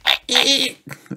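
high piglet giggle squeak; squeaky laugh. Acted. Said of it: "squeaks George".

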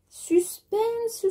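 high, drawn-out mock-suspense call; playful suspense. Vlogger teasing viewers before measuring fabric, high playful suspense. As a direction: sing it out high to tease.

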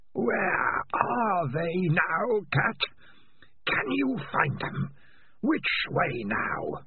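slow, high and sinister; drawn-out mocking creature voice; eerie alien voice. Alien voice taunts.

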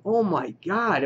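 high, slow stunned gasp of disbelief; mock horror at a shock twist; reacting like a fan gutted by the death. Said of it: "and you're just like".

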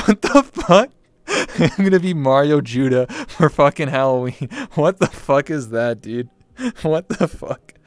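laughing hard, words breaking through; can't stop laughing at the idea; real laugh through the words; laughing disbelief. Real, not acted. Laughing at [name] idea.

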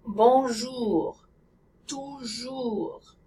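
"bonjour", "toujours" with a deep rounded French oo. Shown as a demonstration. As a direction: round the lips, tongue back, on "bonjour" and "toujours". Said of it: "The French oo sound has a deeper sound".

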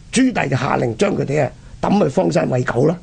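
ordinary talk, nothing marked

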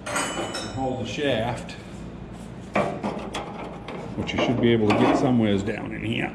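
ordinary delivery

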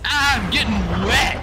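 goofy drawn-out shout; imitated joking holler. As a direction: whoop it out, stretching the words. Imitates Isaiah joking in the rain.

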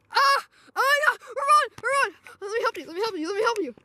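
high panicked cries, running away; panicked screaming. Acted. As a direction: scream and flee in terror. Attacked, fleeing in skit.

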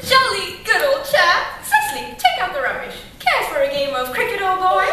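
loud, hammed-up British toff; plummy voice. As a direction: lay on the plummy British accent. Mocking an Englishman's posh talk.

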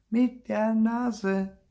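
high, very slow, word by word; emphatic repeat. A narrator's reading. Sister repeats the trick for baffled brother, slow and emphatic. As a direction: spell it out slowly in a high voice.

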